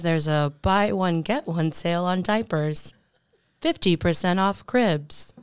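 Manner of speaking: loud, put-on sale announcer voice; [name]. Shown as a demonstration. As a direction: pitch the sale in a fake voiceover voice. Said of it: "my fake voice".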